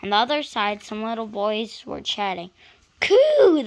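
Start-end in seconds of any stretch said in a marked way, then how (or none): 3.0-3.6 high, loud gleeful coo; child's call. Acted. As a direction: call out in delight. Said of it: "they called".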